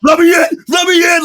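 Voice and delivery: high, loud yelping "let me in"; dog begging to come in. Retold or imitated. Host voicing the chasing dog, high yelping shout.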